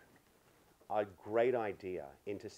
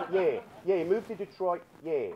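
loud, high mock-Aussie insistence; teasing take on an Aussie friend; Australian accent; 8 semitones higher, 8 dB louder than usual. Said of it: "poking fun at my beautiful Aussie friend".

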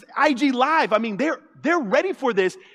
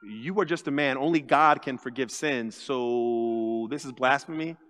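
much lower, softer doubting voice; suspicious critics; 11 semitones lower, 6 dB quieter than usual. Retold or imitated. Haters questioning Jesus, low and doubtful. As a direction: mutter it like a skeptic. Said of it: "the haters around are like".

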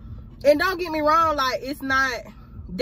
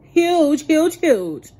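each huge punched higher and louder; emphatic huge; 8 semitones higher, 9 dB louder, much slower than usual. Acted. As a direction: hammer home how big it is. Exaggerating the camera's size.

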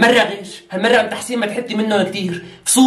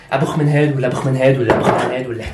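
mock deep voice; 10 semitones lower than usual. Mocking deep voice rummaging perfumes.

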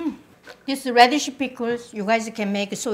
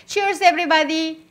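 raised high cheers to everyone; cheerful toast; 6 semitones higher, 3 dB louder than usual. Real, not acted. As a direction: lift the glass, bright and high. Toasting viewers with beer.

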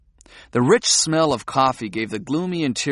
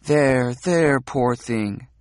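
mock comforting; much slower than usual. Comforting pretend child.

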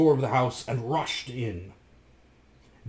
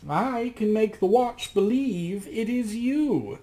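higher, slow, a voice unlike the narrator's; crafty magician; 9 semitones higher, slower than usual. Acted. The magician slyly asks Rasmus for his reflection. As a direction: demand it smoothly, like a scheming sorcerer.

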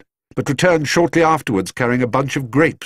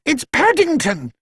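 housekeeper voice. A narrator's reading. Mrs Bird voiced, urgent.

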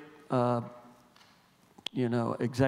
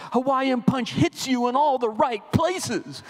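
much higher, slow and drawn-out executive voice; smug ad executive; 9 semitones higher, slower than usual. Retold or imitated. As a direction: lean back and croon the slogan like a pleased ad man. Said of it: "the one guy goes".

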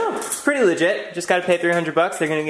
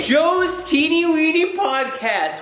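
excited; 10 semitones higher, much slower than usual. Real, not acted. Reading gift name in awe.

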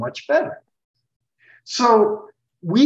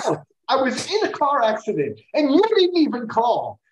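hurt reproach; 6 semitones higher than usual. Retold or imitated. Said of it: "He goes".

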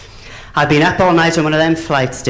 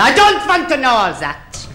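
put-on high, slow Germanic-accented boss; stern mock-German interviewer, spelling it out slowly; Nicky Lauda; 8 semitones higher than usual. Airline boss testing a pilot applicant, stern caricature. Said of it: "And Nicky Lauda said".

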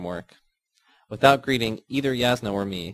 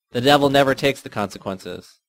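louder, higher retort; sharp retort; 4 semitones higher, 7 dB louder than usual. Acted. Myrna cutting Yasna off, loud and sharp. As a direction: throw it back at her hard.

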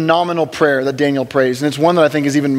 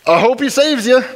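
high, sneering hope; mocking challenge; 8 semitones higher than usual. To a believer, a high sneering taunt. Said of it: "like a challenge against God".

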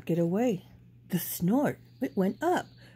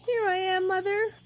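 calling out; 11 semitones higher than usual. A narrator's reading. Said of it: "he called out".

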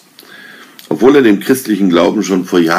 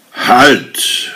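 sharp booming command, higher; thundering halt; 6 semitones higher than usual. A narrator's reading. The wise king stopping Arne at the door, booming angry shout. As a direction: stop him dead with one thundering word. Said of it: "donnerte eine wütende Stimme".

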